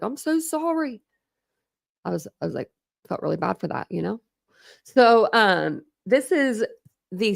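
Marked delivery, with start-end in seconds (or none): 0.0-1.0 retold apology. Streamer retelling apology after everybody came for her, high and pleading. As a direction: pitch up a small pleading sorry.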